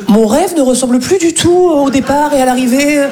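high-pitched theatrical declaiming; mock tragic lament. Shakespearean actor laments his underwear advert, mock tragic. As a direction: lament like a wounded stage tragedian.

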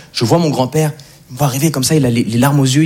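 usual speaking voice

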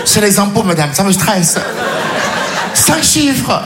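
ordinary talk, nothing marked

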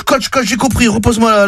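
eager, high-pitched calling; excited. Acted. Player rushing back to his coach, excited. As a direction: call out eagerly, pitched well up.

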